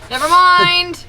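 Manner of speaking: loud, fed-up cry; exasperated shout. Real, not acted. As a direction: throw up your hands and yell it. Bear rejects the jerky, shouted.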